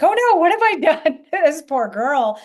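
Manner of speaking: high, loud alarmed cry with a laugh breaking in; mock panic. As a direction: play it as a half-laughing retold panic. Panicked at client moving on table.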